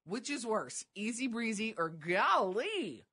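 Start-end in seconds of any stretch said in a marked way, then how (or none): 1.9-3.0 "golly" swooping up and down on both syllables; emphasized golly. Retold or imitated. Cohost comparing two catchphrases, golly heavily emphasized. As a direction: lean hard on "golly". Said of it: "you're emphasizing golly".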